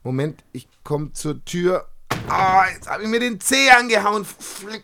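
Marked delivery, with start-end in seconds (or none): 2.3-4.8 pained cry. Acted. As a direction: yelp of pain from stubbed toe. Stubs toe in the dark.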